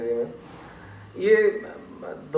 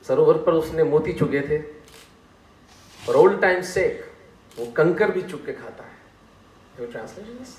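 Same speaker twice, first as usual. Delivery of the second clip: ordinary delivery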